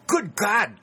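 sudden high, loud cry of horror; cried out. A narrator's reading. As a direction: burst out in shock, high and loud. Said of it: "cried Dick".

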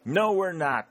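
high, emphatic shouted retort; defiant shout. A narrator's reading. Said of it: "shouted the sergeant".

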